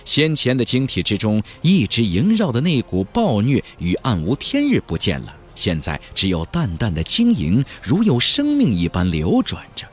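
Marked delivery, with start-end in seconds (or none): none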